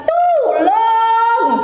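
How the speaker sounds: high sung cry for help; goat-like plea, sung out; mock goat plea. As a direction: wail it like a bleating goat. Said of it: "ketiga bilang".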